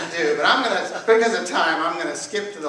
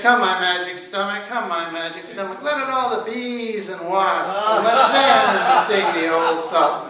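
rooster incantation; 3 semitones higher, 8 dB louder than usual. A narrator's reading. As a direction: start as a chant, stretch into a loud command. Rooster summons bees onto Sultan.

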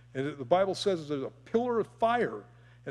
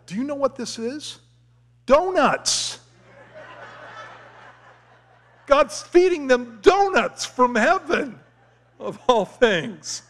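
amused delight; 8 semitones higher, 8 dB louder, slower than usual. Acted. Preacher joking about manna as donuts.

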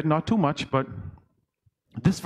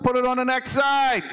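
retold shout; 6 semitones higher than usual. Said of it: "So he shouted out".

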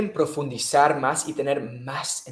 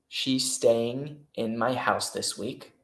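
slow read option; 2 semitones lower, slower than usual. Shown as a demonstration.

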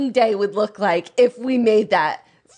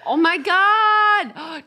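shocked; 9 semitones higher, 5 dB louder, much slower than usual. Real, not acted. Reacting to porn being read.

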